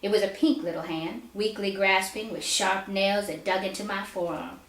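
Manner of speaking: plain reading voice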